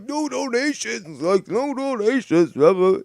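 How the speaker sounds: mock his complaint in a high whine; imitating whiny Tony. Mocking streamer Tony whining about no donations. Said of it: "Tony was bitching about his stream".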